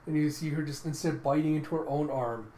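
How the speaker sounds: usual speaking voice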